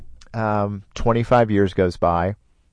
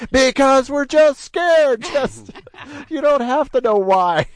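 high, slow, odd little voice; his nervous heart talking back to him; 4 dB louder, slower than usual. Retold or imitated. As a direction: give the heart its own squeaky say. Voicing his pounding heart.

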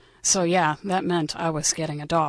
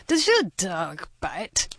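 put-on cheesy French accent; much slower than usual. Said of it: "in his cheesy Frenchy accent".

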